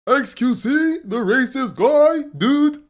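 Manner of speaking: hammed-up sarcastic impression; mocking quote. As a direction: parody the other guy's version of you. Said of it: "I don't ever recall saying".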